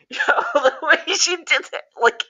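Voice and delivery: high, loud laughing disbelief. Real, not acted. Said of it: "I was like".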